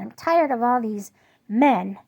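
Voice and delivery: loud, slow, fed-up rant; fed-up complaint to husband; retold anger. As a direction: hammer each word, loud and slow. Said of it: "I said".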